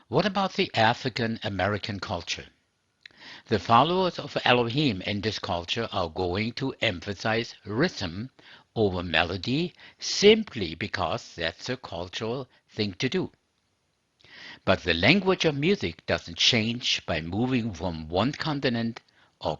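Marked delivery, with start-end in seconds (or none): none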